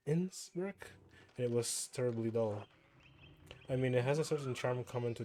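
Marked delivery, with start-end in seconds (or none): 0.1-0.9 "Innsbruck?" thrown up much higher as a startled question; "Innsbruck?" with a sharp rising surprise; surprised echo. Shown as a demonstration.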